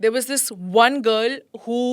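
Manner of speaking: ordinary delivery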